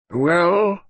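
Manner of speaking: a lightly voiced weary old colonel; gruff old colonel. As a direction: bark it out, half awake. Pikeaway answers intercom.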